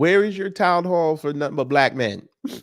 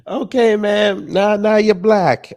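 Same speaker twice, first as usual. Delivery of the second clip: slow, louder, sarcastic drawl; mocking disbelief; 3 semitones higher, 6 dB louder, slower than usual. Real, not acted. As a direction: stretch it out to mock her. Mocking the candidate's claim.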